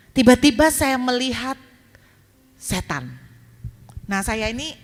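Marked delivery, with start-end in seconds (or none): 2.6-3.1 setan dropped low for a spooky reveal; ominous payoff word; dramatic reveal. Acted. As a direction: let the word setan fall low and heavy. Ghost story reveal in sermon.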